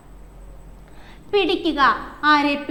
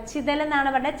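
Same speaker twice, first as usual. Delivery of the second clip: usual speaking voice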